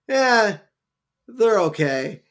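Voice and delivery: high, loud, unimpressed shrug; dismissive Patty voice. Retold or imitated. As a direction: brush off the gift, loud. Patty brushing off Skinner's gift.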